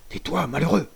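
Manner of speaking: high, sharp warning cry; urgent exclamation. Acted. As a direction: cut him off before he talks. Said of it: "s'écria Danglars".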